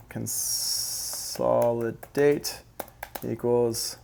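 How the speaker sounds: "Consolidate" stretched out very slowly, syllable by syllable, rising at the end; slow dictation. Shown as a demonstration. Producer writing a note for viewers, slow drawn-out dictation. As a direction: drag out "Consolidate" as if typing it. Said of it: "Let's write that down".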